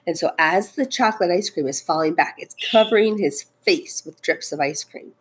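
ordinary delivery, nothing marked

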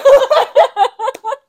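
loud high cackle; gleeful laugh. Real, not acted. Host finishing the April fools joke, high cackle. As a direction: cackle at the prank.

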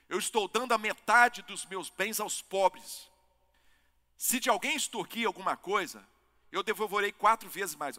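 acted declaration. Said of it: "Zaqueu levantou-se e disse ao Senhor".

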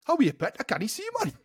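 high-pitched Geordie demo; Geordie accent. Shown as a demonstration. Host revealing the actual answer, high Geordie accent. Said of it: "the actual answer was".

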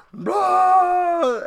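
high, loud triumphant yell; a loud, high victory shout; mock shout. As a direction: bellow it as you cross the line. Shouting while crossing finish line.

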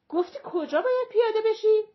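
high, loud, sudden inquiry; urgent question. A narrator's reading. Mother asking passenger her stop, urgent. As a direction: call it out across the bus.

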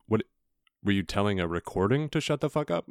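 ordinary delivery

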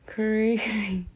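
laughing through "crazy". Real, not acted. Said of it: "repeated with a laugh".

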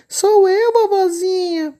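wolf pretending sweetly to be the child; wolf faking a little girl. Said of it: "imitando a voz de uma garotinha". (lobo fingindo voz de menininha)

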